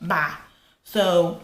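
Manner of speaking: normal talking voice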